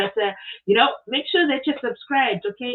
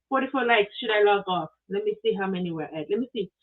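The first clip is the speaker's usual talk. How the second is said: ordinary talk, nothing marked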